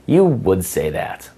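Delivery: slow, knowing teasing line; sassy tease. Shown as a demonstration. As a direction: drag it out like you've caught her. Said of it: "another one is".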